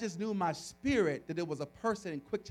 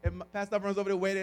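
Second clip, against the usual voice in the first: usual speaking voice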